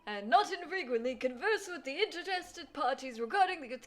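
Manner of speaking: put-on loud, high rambling old gentleman; old man voice. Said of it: "that old man is saying".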